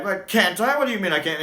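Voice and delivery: the Doctor offended at Harry; indignant Doctor. Retold or imitated. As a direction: bristle at being told no. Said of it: "the Doctor's like".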